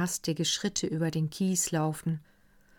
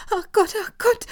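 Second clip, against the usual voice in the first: much higher, louder wail; 8 dB louder, faster than usual. A narrator's reading. Maid Lina wails to the household. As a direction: lament in shock. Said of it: "jammerte Lina".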